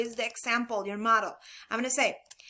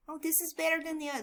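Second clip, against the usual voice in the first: mock wrong example; 4 semitones higher, 7 dB quieter, much faster than usual. Shown as a demonstration.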